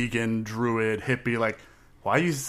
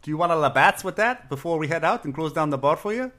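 higher, badly faked Canadian accent; rough fake Canadian; 5 semitones higher than usual. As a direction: overdo a Canadian bar regular's accent. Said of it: "that was rough".

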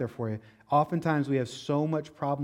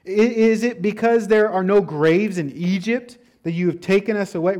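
louder, higher complaining retold; 5 semitones higher, 8 dB louder than usual. Preacher voicing Israelites complaining to Moses, louder whine. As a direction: whine it like scared grumblers. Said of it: "They start to complain".

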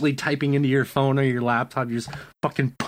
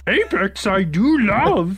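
higher, slow Yoda word order; slow, high Yoda impression; 7 semitones higher, 4 dB louder, much slower than usual. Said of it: "Hello, Yoda".

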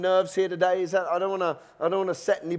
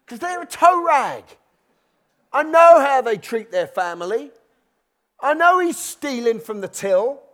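resentful grumbling; 6 semitones higher than usual. Acted. Believer resenting a neighbour who prospers, mock indignant. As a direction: gossip about the neighbour with hammed-up resentment.

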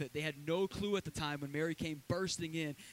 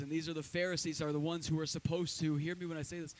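normal talking voice

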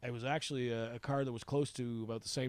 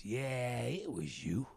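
Bay. Retold or imitated. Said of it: "and he just goes".

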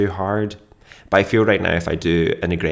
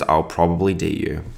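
ordinary talk

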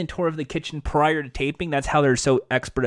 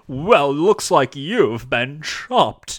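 slow, stately butler voice; lightly hammed-up posh butler; much slower than usual. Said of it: "like a Richie Rich".

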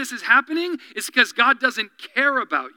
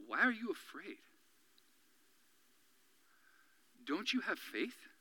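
soft, gentle rebuke; 4 semitones lower, 19 dB quieter than usual. Retold or imitated. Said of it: "says to them".

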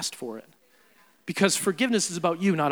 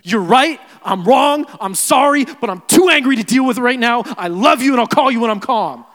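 retold heated apology; 5 semitones higher, 10 dB louder than usual. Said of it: "And I was like".

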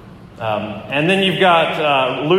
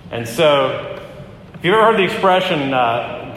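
normal talking voice

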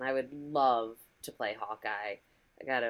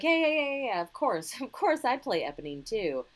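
higher, louder burst of yeses, then settling; mock eager agreement; 9 semitones higher, 3 dB louder than usual. Twelve-year-old going along with the girls, mock eager agreement. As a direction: agree too readily, playing along.

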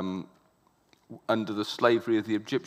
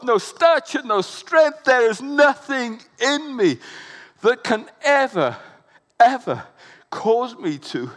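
high, loud, slow cry of woe; despairing Isaiah; 11 semitones higher, 8 dB louder, slower than usual. Acted. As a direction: wail it out, undone before God. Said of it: "he realized".

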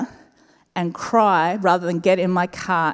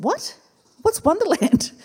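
high-pitched bewildered question; retold bafflement, pitch jumping up; puzzled question; 9 semitones higher than usual. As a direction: ask it high, utterly puzzled. Retells her puzzled reply to lady.

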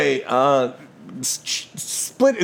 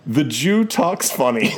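laughing; 6 semitones higher, slower than usual. Retold or imitated. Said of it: "It just says".

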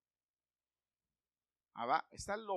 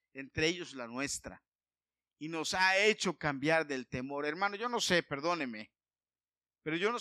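loud, slow, fervent preaching; full-force preaching; 9 dB louder than usual. Acted. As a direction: proclaim it to the congregation.

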